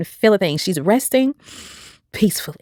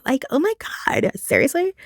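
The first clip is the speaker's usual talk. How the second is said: disbelief; 9 semitones higher than usual. Real, not acted. Shocked at her own dream.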